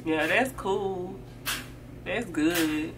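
barely bother to react; jealous friend's dry, quiet "that's cool"; unimpressed friend. Retold or imitated. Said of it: "And your friend like".

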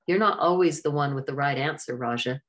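plain reading voice

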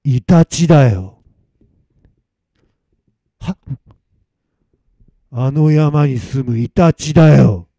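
slow, loud weasel-spirit voice; a drawn-out, forceful reveal from a spirit; possessing weasel spirit. Acted. Weasel spirit telling Masamitsu what it is, slow and menacing.

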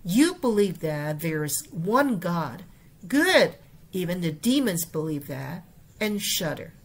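整句示范朗读; model reading. Shown as a demonstration. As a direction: read the full verse through with feeling. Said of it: "我们整句来过".

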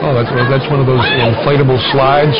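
usual speaking voice

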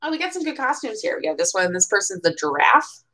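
ordinary delivery

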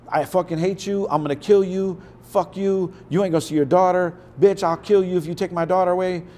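high, fast, heated threats tumbling out; imitated fight. Marshall blowing up at Kim in a fight, heated rapid threats. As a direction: throw the insults fast like mid-blowup.

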